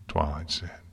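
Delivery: ordinary delivery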